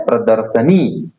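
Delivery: प्रदर्शनी said slowly, short नी at the end; slow correct form. Shown as a demonstration. Teacher quizzing students, answering slowly himself. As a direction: sound out प्रदर्शनी for the class. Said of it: "ये क्या होता है?".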